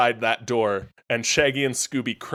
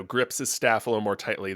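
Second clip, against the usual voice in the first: normal talking voice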